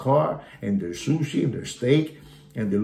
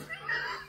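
very high giggle; a quick falsetto laugh; tiny high laugh at his friend; squeaky laugh; 13 dB quieter than usual. Real, not acted. Laughs at Kino.

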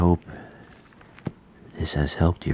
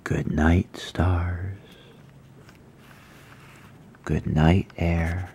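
bedtime story reading; 7 dB quieter, slower than usual. Acted. Reads bedtime story to viewers.

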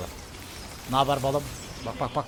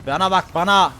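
louder and higher, yelled at the enemy; taunting shout; 7 semitones higher, 9 dB louder than usual. Real, not acted. Gamer yelling at his opponent mid-fight, loud taunting shout. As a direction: bellow it as a taunt.

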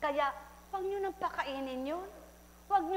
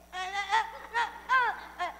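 high, straining wordless ah sounds; mute man's grunts; 7 semitones higher, 3 dB louder than usual. A narrator's reading. Mute man trying to speak, high wordless grunts. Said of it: "Sabi naman ng pipi".

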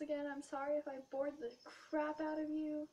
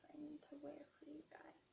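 whisper; 10 dB quieter than usual. Real, not acted. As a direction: drop to a secret whisper about new stockings. Whispered teasing aside.

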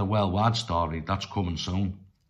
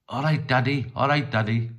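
retold call across the road, a bit louder and higher; mates shouting; 5 semitones higher, 3 dB louder than usual. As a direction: call out cheerfully to a new dad. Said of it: "people were shouting".

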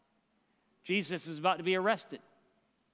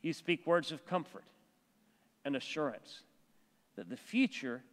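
closing prayer; 3 semitones lower than usual. Acted. Pastor leads closing prayer.

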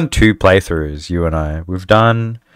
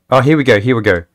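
normal talking voice

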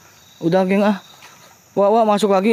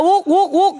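high imitated monkey call; monkey noise; 9 semitones higher, slower than usual. Telling the crew about a monkey sound, imitating it high. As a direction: mimic a monkey's whooping, pitched up. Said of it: "suara monyet".

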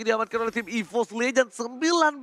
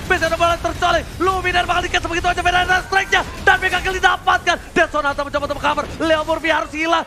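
higher, louder rapid play-by-play; fighting game announcer; 4 semitones higher, 3 dB louder, faster than usual. Real, not acted. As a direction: build the hype fast. Caster calls a base push.

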